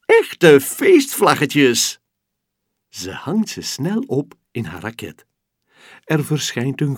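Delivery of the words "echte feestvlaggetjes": high, loud cry of delight; gleeful child cheering; happy shout. Acted. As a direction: shout it overjoyed. Continues joyful cry.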